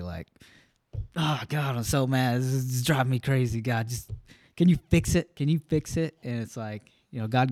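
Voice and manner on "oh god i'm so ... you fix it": half-hearted frustrated pleading, rising on can you fix it; angry prayer. Retold or imitated. Venting to God in his truck, mock-angry pleading. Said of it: "praying, like, angrily".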